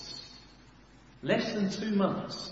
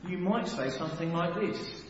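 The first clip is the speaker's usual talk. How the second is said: ordinary delivery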